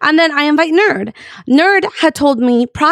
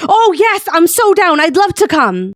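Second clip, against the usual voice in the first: higher-pitched, imitated eager yes; mock eagerness; 5 semitones higher than usual. Friend suddenly replies to the event invite, mock eager. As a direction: jump at the invite, imitating him.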